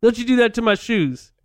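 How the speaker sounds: put-on high Italian-accented scolding; hammed-up Italian accent, scolding teens; Fabrice voice. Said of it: "And he's like".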